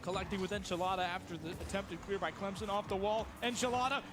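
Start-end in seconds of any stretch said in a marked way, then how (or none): 3.4-4.0 screaming the goal call, pitch soaring; long goal yell. Real, not acted. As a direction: belt out the goal like a stadium caster.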